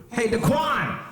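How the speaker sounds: put-on high child's holler; kid calling out. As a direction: call a friend across the yard. Kid calls out to friend.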